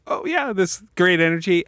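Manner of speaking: match her upbeat energy; excited. Retold or imitated. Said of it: "And I'm excited too".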